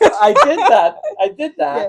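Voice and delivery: high and loud, giggling it out; laughing admission. Real, not acted. Admitting she paid with the cup of change, laughing. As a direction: own up while cracking up.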